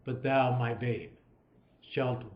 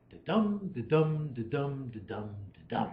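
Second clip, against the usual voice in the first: da-dum chanted with the second beat punched; rhythm demo. Shown as a demonstration. Teacher showing students the iambic beat, chanted da-dum. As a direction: tap out the iambic beat, weak then strong. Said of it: "that line goes something like".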